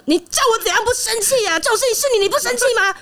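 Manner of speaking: high, laughing mock outrage; exasperated retort. Wife snapping back at her calm husband, laughing and high. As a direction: toss the question back, half laughing.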